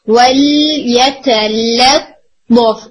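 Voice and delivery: ولیتلطف very slow, both ل light and spread; slow recitation, light lams. Shown as a demonstration. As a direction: recite ولیتلطف slowly with two thin lams. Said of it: "لطف نہیں". (ولیتلطف آہستہ، دونوں لام باریک)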